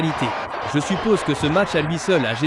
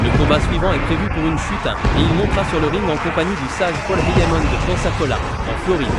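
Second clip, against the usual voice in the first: booming wrestling ring announcer. Acted. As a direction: announce the champion to the arena.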